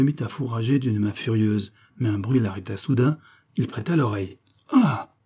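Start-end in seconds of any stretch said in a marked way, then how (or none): 4.7-5.1 sharp high startled Ah; the baron hears a sound, cries out; sudden cry. Acted. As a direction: jolt out a cry at the noise. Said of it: "s'écria-t-il".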